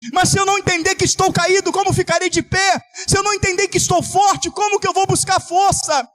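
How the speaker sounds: high, driving sermon climax; impassioned preaching. Acted. Pastor crying out to the church at full force. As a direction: preach it out to the congregation.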